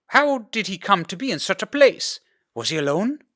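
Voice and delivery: high anxious questions; mother pressing for answers; worried mother. A narrator's reading.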